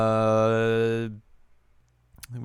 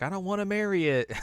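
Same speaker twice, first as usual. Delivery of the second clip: whiny refusal; 9 semitones higher than usual. Retold or imitated. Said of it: "And she's like".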